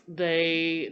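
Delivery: normal talking voice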